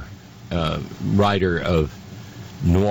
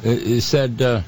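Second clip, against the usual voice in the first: ordinary talk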